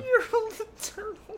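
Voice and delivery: squeaky high-pitched joke name; a cartoonish high squeak; silly high voice. Joking name for the battle royal.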